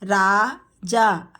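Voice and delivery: Raja drawn out slowly; slow teacher model of Raja. Shown as a demonstration. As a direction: stretch Raja syllable by syllable. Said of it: "teacher models name slowly".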